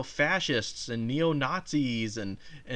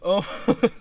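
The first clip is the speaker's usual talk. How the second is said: usual speaking voice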